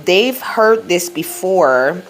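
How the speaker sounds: usual speaking voice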